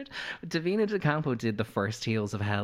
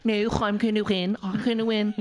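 exaggerated Scouse queen impression; mock Scouse; 10 semitones higher, 3 dB louder than usual. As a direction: drag out a high-pitched Liverpool accent. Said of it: "Liverpool queens talking like that".

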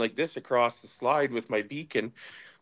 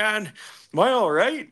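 higher, louder imitated panic; worried injured man; 9 semitones higher, 4 dB louder than usual. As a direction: ask it pitched up like a shaken bleeding giant. Injured man voiced in retelling.

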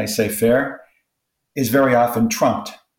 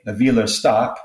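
ordinary talk

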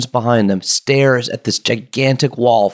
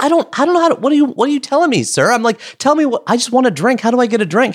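much higher, rushed, confused pleading; flustered; 10 semitones higher than usual. Retold or imitated. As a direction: sound caught off guard and helpless. Flustered customer facing swearing bartender.

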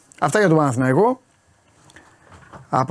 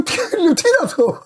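high-pitched mock astonishment; retold startled disbelief; 14 semitones higher than usual. Fan retelling his shock at the match, mock startled. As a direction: re-enact being taken aback, voice shooting up.

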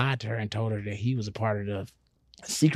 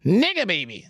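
high, put-on character squeal; cartoon voice; 9 semitones higher than usual. Comedian repeats cartoon line on boss's order, silly voice.

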